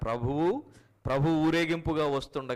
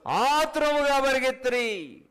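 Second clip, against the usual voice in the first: much higher, louder, slow and dramatic; emphatic reading; 11 semitones higher, 6 dB louder than usual. Acted. Preacher reading the key verse, slow loud emphasis. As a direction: stretch out the haste in the verse. Preacher answering his own question.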